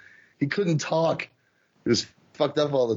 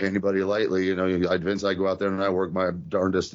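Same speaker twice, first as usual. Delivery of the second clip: much lower, fast, earnest aw-shucks promo; lower, earnest wrestler promo impression; Bob Backlund impression; 6 semitones lower, faster than usual. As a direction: rattle it off like an eager clean-cut babyface. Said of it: "It would be stuff like this".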